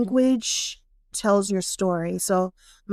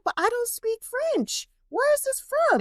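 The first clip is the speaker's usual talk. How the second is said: high-pitched incredulous complaint; baffled protest; 14 semitones higher, slower than usual. Retold or imitated. Woman discovers her Frenchy accent, baffled protest. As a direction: act out bewilderment, voice climbing very high.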